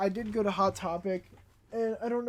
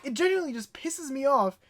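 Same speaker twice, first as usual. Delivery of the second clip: raised angry voice, fast at the end; yelling; 7 semitones higher than usual. Real, not acted. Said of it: "I know I'm yelling".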